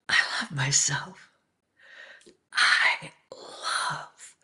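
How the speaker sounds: slow whispered affirmation, the I leaned on a little; self-love mantra, whispered slowly. Acted. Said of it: "let this be your mantra".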